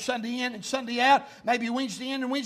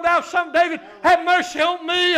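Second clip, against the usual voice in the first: loud, high imitated plea from the pulpit; crying out more; 7 semitones higher, 10 dB louder than usual. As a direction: cry it out with added push. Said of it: "he cried out the more".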